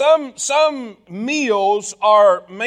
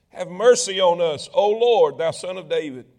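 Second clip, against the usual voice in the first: ordinary talk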